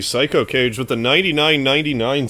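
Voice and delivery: loud, high excited shout; surprised. Real, not acted. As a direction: blurt it out in shock. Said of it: "when you get really surprised like that".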